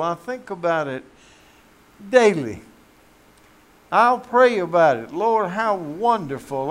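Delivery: loud, slow, ringing preacher's call; fervent preaching. Acted. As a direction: lift it up like a revival sermon. Preaching at full force.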